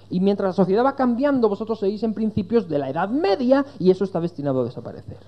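high, loud, impassioned imitation of a sceptic; arguing friend. Preacher acts out his friend's case against Christianity, high insistent harangue. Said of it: "Me dijo".

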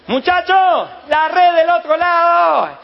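high, loud, slow call across the water; shout from the shore. Acted. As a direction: bellow it across to the fishermen.

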